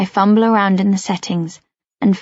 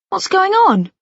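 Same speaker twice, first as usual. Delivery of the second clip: alarmed dad; 10 semitones higher, 3 dB louder than usual. Acted. Father runs up alarmed.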